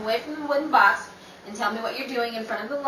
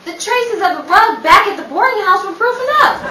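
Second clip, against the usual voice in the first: sharp scolding; 10 semitones higher, 10 dB louder than usual. Acted. Rachel scolding Mason.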